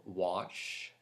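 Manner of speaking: normal talking voice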